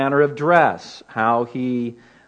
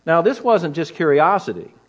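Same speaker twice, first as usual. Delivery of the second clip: ordinary talk, nothing marked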